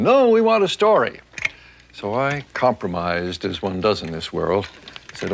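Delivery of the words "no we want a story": put-on kids howling. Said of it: "they howled me down".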